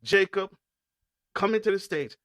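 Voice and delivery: big, high-pitched stage introduction; hype announcer intro. Acted. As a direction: bring the guest on like an MC. Host announces guest on stage.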